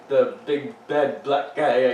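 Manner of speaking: slow, each word placed with care; careful slow wording. Real, not acted. Slowed careful rephrasing.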